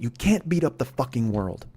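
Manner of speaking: normal talking voice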